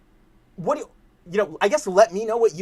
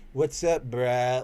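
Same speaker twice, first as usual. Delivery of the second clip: slow, laid-back surfer drawl, imitated; surfer dude; 4 semitones lower, much slower than usual. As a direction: put on a lazy surfer cadence. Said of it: "You have that surfer dude".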